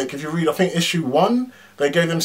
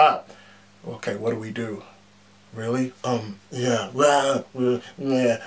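mock Carol; 2 semitones lower than usual. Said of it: "She was like".

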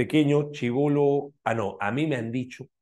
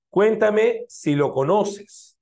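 slow read-out; 10 semitones higher, 7 dB louder, much slower than usual. Shown as a demonstration. Teacher reading a sentence aloud.

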